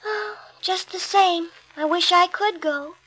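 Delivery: wistful sigh. A narrator's reading. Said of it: "sat by the fire and sighed".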